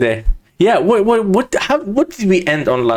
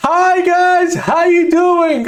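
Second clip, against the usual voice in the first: high, drawn-out mock crowd holler; imitating loud voice; 13 semitones higher, 3 dB louder than usual. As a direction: holler it like addressing a hall without a mic, mocking him. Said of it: "I have a loud voice".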